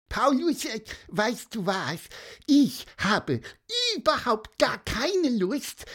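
determined little creature, pitched high; small bird refusing to be upset; high squeaky bird voice. Acted. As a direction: squeak it out with stubborn resolve. Bird Pieper talks to Paulus.

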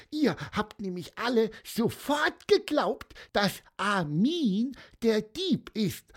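high piping bird, as stern as he can; strict squeaky bird. A narrator's reading. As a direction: scold everyone like a tiny strict bird. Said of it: "piepste er, so streng er konnte".

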